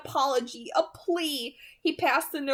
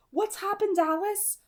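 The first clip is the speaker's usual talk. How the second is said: higher voice for Carlisle, gently questioning; a raised, asking voice for the doctor; 10 semitones higher than usual. A narrator's reading. Carlisle asking Alice what happened, higher-voiced. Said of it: "I heard Carlisle ask".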